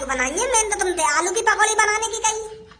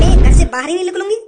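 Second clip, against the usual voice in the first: louder, fast defiant snap back; loud retort; 9 dB louder, faster than usual. Acted. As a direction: fire it back loud and quick. Defiant reply to threat. (ज़ोर से, तेज़ पलटवार)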